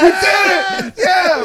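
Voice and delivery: high, loud victory cry; hammed-up celebration yell; mock triumphant shout. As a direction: cheer like you finally won. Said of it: "me and Parker were like".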